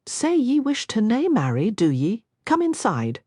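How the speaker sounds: a lighter Scottish lilt; light Scottish burr. A narrator's reading. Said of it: "his Scottish burr was not nearly as thick".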